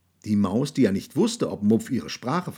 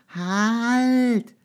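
high, loud squeaky call; mouse calling; 13 semitones higher, 4 dB louder than usual. A narrator's reading. Mouse calls the monster for the hundredth time, high and loud. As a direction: shout it like a tiny mouse. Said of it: "gerufen hatte".

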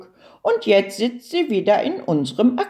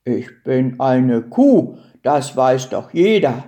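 much lower cow voice; matter-of-fact cow; 7 semitones lower, 3 dB louder than usual. A narrator's reading. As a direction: answer deep down, as a cow stating the obvious. Cow answers the turtle.

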